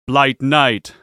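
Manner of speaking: booming slow announcer call; dopey cartoon bellow. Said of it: "you hear a booming voice".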